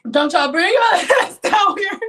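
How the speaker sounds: high loud shout through laughter; laughing shout. Real, not acted. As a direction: yell it while cracking up.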